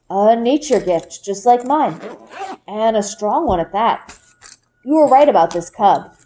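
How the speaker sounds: a father gently telling his daughter the news; Papa. A narrator's reading. Papa's lines in read story.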